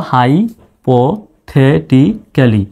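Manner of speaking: Hypothetically drawn out very slowly; slow syllable demo. Shown as a demonstration. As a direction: stretch hypothetically, each syllable held. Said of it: "এবার একসাথে উচ্চারণ করুন".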